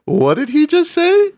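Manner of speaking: high, stunned scammer voice, slowed at the end; hammed-up shocked scammer; mock scammer. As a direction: squeak out the scammer's disbelief. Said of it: "He was like".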